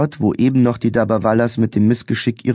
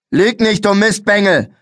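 much higher, louder furious yell; furious shout; 10 semitones higher, 4 dB louder than usual. A narrator's reading. Policeman screaming at the boy for lying, furious. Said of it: "schrie der Polizist wütend".

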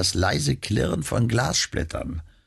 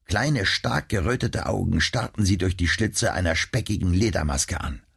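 usual speaking voice

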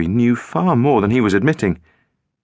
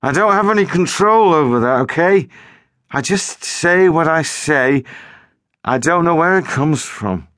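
higher, irritated, slowing; tetchy; 7 semitones higher than usual. A narrator's reading. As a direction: snap back defensively. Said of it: "The boy sounded tetchy now".

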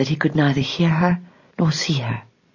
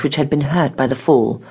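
ordinary delivery, nothing marked

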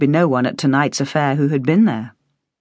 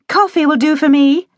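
put-on higher young woman's voice; politely decline, higher and light; Penny; 12 semitones higher, 5 dB louder than usual. A narrator's reading. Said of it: "she answered".

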